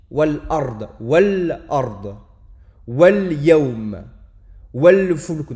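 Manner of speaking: wal ard, wal yawm, wal fulk, le lam bien net et plus fort; lam prononcé. Shown as a demonstration. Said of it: "on voit qu'on prononce le lem". (exemples lunaires articulés)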